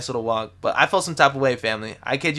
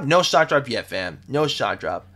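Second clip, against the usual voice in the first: usual speaking voice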